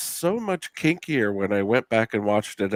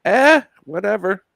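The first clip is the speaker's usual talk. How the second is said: dismissive shrug; 12 semitones higher, 8 dB louder than usual. Retold or imitated. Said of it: "everyone else is kinda like".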